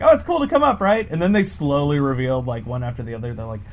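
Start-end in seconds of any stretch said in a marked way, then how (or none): 0.0-1.1 cheery voice. Retold or imitated. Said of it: "I was like".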